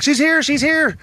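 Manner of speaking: high-pitched re-enacted shout; imitated calling out. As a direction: mimic calling through the house. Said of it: "I hear Heidi through the house".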